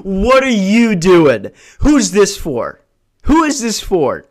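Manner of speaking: high, slow furious questions; angry confrontation. Acted. Comic confronting the flirting guy, angry and slow. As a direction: demand it like a furious customer.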